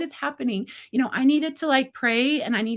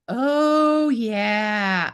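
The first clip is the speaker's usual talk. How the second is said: long drawn-out, knowing acknowledgment; knowing reply. Retold or imitated. Retold knowing reply to his story.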